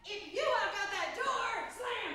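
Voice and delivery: slow plea rising to loud protest; melodramatic jilted lover. Acted. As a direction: play an overwrought abandoned spouse. Monologue, being left by lover.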